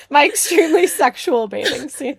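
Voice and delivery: high, loud, through real laughter; helpless laughter breaking into the words; laughing hard. Real, not acted. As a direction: crack up while getting the words out. Cracking up at embarrassing story.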